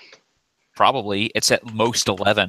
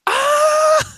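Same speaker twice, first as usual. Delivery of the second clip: very high, loud mock scream; drawn-out screeching "ah"; mock bad-guy shriek, held long; imitated villain scream; 5 dB louder than usual.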